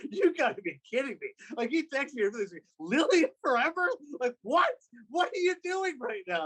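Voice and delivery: high-pitched, flabbergasted disbelief; baffled, can't-believe-it quote; incredulous friend. Retold or imitated. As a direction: act out a stunned friend's reaction, voice jumping way up. Friend's incredulous texts acted out.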